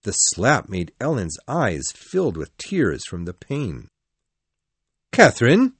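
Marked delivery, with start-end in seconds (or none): 5.1-5.8 loud, high cry of shock. A narrator's reading. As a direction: blurt the name in disbelief. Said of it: "cried Edgar, very surprised".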